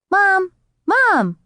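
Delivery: put-on high, loud little girl calling; excited child calling. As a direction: call out eagerly like a small child.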